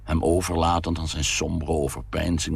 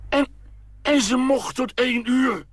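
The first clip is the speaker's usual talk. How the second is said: much higher indignant cry; exclaimed; 3 dB louder than usual. A narrator's reading. Father cries out at his wife, indignant. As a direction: cry out in disbelief. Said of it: "riep hij".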